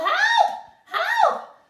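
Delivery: very high, loud cries for help; yelling for help. Acted. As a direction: scream as if trapped. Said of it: "pounded on the door and yelled".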